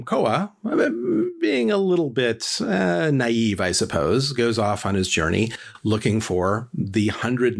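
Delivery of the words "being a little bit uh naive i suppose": plain reading voice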